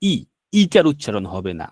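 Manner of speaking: ordinary talk, nothing marked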